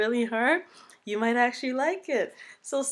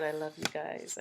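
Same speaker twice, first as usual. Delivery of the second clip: usual speaking voice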